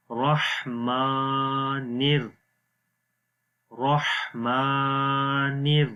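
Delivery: slow رحمانر with 'maa' held long; heavy 'rah', stretched 'maa' in رحمانر; lengthened meem. Shown as a demonstration. Teacher drilling the word, رحمانر with a long drawn vowel. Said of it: "اس کو بھی لمبا کریں گے".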